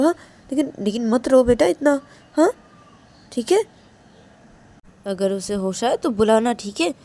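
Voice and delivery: concerned elder consoling. Acted. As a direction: coax a crying child to sit. Man reassures and instructs Ananya. (चिंतित बुज़ुर्ग, ऊँचे सुर में मनाते हुए)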